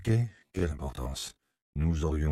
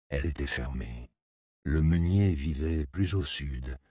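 ordinary delivery

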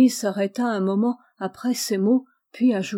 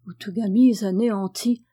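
ordinary delivery, nothing marked

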